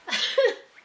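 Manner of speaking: short high, loud laugh; emotional laugh. Real, not acted. Nurse admitting she let fear in, laughs high.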